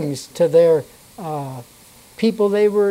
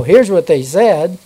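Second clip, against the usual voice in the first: normal talking voice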